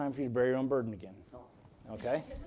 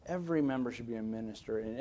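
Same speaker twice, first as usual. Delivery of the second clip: soft, lower-pitched repeat; quiet restatement; 4 semitones lower, 9 dB quieter than usual. Real, not acted. Preacher repeats his belief to the church, softly. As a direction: bring it right down and let it settle.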